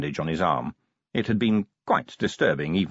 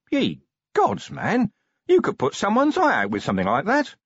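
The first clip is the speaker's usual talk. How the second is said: much higher, startled outburst; shocked exclamation; 4 dB louder than usual. A narrator's reading. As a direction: blurt it out, aghast. Shocked at sharpened pennies.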